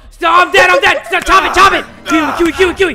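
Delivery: high, loud panicked bursts; frantic yelling. Real, not acted. Player being caught by the girl, frantic yelling. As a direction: yell in a scramble to survive.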